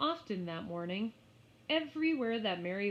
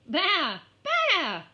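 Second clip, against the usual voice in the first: high, loud bleating; lamb bleat; 10 semitones higher, 13 dB louder than usual. Acted. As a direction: bleat sharply at her feet. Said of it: "went the little lamb".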